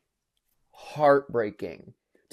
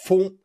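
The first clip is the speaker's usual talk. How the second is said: imitated sound effect; 5 semitones higher, 7 dB louder than usual.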